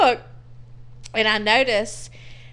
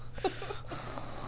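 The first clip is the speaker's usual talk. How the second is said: imitated wheezy sigh; 11 semitones higher, 15 dB quieter than usual. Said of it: "he's like".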